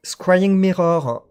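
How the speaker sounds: card name read slowly and loudly in French-accented English. Real, not acted. Reading an English card name to viewers, French-accented English. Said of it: "excusez-moi pour mon accent anglais".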